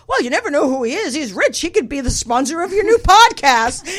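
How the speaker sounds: high, loud, insistent imitation; excited urging friend. Friend urging host to consider rich man, excited imitation. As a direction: pitch it like a pushy excited friend.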